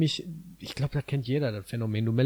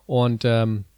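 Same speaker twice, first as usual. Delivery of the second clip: usual speaking voice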